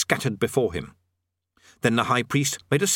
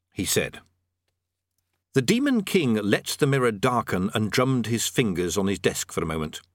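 usual speaking voice

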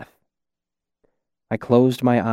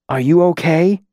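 lightly raised, questioning character voice; concerned rescuer; 5 semitones higher than usual. Acted. Masked man to a beaten kid in the snow, concerned. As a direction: check on someone hurt, pitch lifting.

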